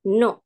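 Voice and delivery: "No" falling sharply; fourth tone. Shown as a demonstration. Teacher models tone four, "No" as a firm fall. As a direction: drop the pitch firmly on "No".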